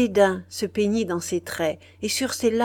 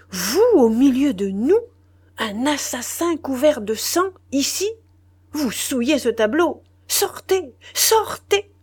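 loud, much higher raging outburst building to a shout; furious; 7 semitones higher, 6 dB louder than usual. A narrator's reading. The general orders the bloodied murderer out, in mounting fury. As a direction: drive him out with mounting rage. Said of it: "avec un accent de fureur".

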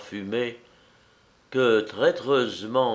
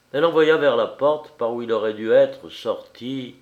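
ordinary delivery

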